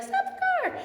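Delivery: put-on sudden, very high child's cry; excited cry. Girl spotting her dream house from the car, crying out high. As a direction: blurt it out the moment she sees the house.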